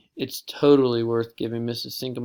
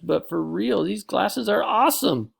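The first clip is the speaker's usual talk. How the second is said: gushing; 8 semitones higher, 5 dB louder than usual. Acted.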